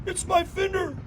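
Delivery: put-on high childlike oh oh, realizing; lightly voiced toddler; child's discovery. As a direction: act the little kid finding the hurt. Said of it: "they finally go".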